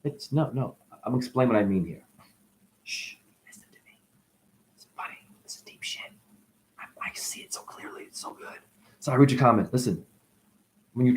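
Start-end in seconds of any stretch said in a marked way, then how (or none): 2.9-8.6 secretive whisper, quick and hushed. Acted. Hushes listener, confides secret.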